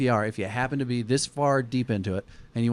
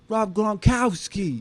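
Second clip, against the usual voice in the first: put-on higher, slow, loud Boston accent; 9 semitones higher, 3 dB louder, much slower than usual. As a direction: belt the name in a Boston accent. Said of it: "how were they saying?".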